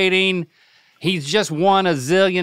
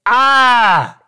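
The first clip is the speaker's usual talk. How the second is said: shouted ah with tongue out; loud ah; 7 semitones higher, 6 dB louder than usual. Shown as a demonstration. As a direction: belt the ah out, tongue out. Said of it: "Just stick your tongue out and say".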